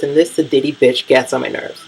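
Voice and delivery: usual speaking voice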